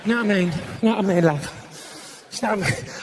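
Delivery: imitated Cockney market-stall patter; low Cockney mimicry; East End accent. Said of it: "sounds like she's working on a fruit and veg stall in the East End".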